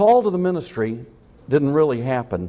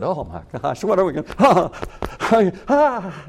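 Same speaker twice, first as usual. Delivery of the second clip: lightly acted panic; a hint of desperate worshippers, flustered; acting out desperate priests; 4 semitones higher than usual. Preacher acting out the priests of Baal, mildly panicked. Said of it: "I'm acting it out a little bit".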